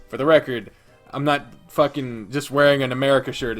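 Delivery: ordinary talk, nothing marked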